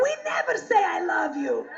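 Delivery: mock-outraged Russian accent; Russian mother, outraged. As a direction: cry out as an appalled Russian mother. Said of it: "Here's what my mother said to me".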